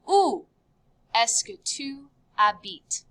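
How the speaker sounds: "Où" alone, pause, then the rest; measured model with "habites" falling; broken into parts. Shown as a demonstration. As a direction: read the question piece by piece for learners. Teacher first reads question in parts.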